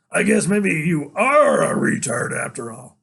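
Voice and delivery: a killer mocking his captive; villain sneering.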